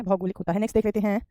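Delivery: ordinary talk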